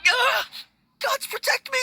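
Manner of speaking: high, panicked yelp of fright; terrified cry. Acted. Frightened undertaker, cornered by strangers, crying out in terror. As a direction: cower and cry out to the gods.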